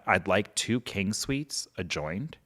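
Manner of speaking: slow, measured imitation of a hotel guest; slow, unhurried retold request; deliberate request. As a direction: order it slowly, spacing out the words. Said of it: "He says it again".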